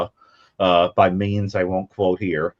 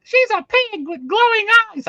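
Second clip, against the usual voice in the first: very high, loud mock child voice; imitating movie kid; 5 dB louder than usual. Kid describing the creature, mock child voice. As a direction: put on a piercingly high little-kid voice. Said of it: "And they're like".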